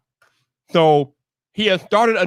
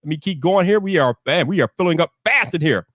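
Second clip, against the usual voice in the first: normal talking voice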